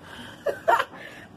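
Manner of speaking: squeaky breathless giggle; high giggle. Real, not acted. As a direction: burst into a high laugh. Laughing after losing count.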